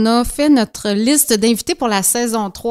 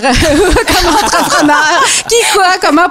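laughing; 6 semitones higher, 3 dB louder, slower than usual. Real, not acted. Host laughing while asking question.

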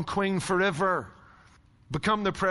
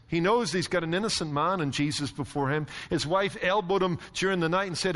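usual speaking voice

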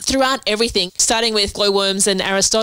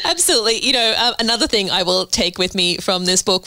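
ordinary delivery

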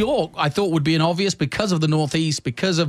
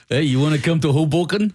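put-on New Jersey accent. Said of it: "I can't do a New Jersey accent".

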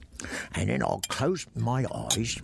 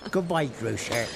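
ordinary talk, nothing marked

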